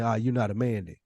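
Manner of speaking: usual speaking voice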